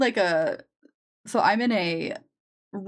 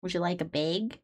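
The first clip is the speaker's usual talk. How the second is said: softer, lower Midwestern accent; 3 semitones lower, 7 dB quieter than usual. Shown as a demonstration. Asking a customer about a bag, quiet Midwestern accent. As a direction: say it quietly like a Minnesota cashier. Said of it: "God, it's awful".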